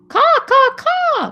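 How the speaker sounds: high, loud cawing; crow caw. Acted. Teacher acting the crow for kids, loud high caws. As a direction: call it out like a crow.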